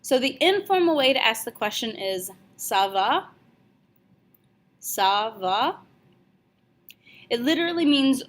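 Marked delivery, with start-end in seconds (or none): 2.6-3.3 "Ça va?" with the voice going up at the end; question rise on "Ça va?". Shown as a demonstration. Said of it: "your voice needs to go up at the end of the question". 4.8-5.8 "Ça va?" with the voice going up at the end; question rise on "Ça va?". Shown as a demonstration. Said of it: "your voice needs to go up at the end of the question".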